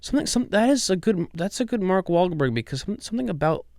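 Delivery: high-pitched attempt at an actor's voice; Mark Wahlberg. Host praising the clip while attempting it, high-pitched impression. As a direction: try out a high celebrity impression. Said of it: "I can't do it".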